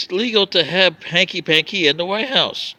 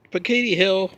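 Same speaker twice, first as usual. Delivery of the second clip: normal talking voice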